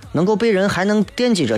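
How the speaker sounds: normal talking voice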